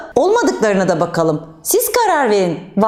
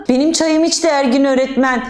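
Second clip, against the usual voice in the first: usual speaking voice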